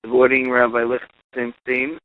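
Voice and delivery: ordinary delivery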